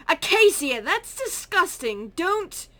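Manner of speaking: loud, high scolding shout; disgusted shout. Acted. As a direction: yell at her in disgust.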